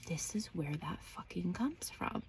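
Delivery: hushed, voiceless whisper; whispered realization. Retold or imitated. Daughter realizing where her inner voice comes from, whispering. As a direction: whisper it as a secret thought.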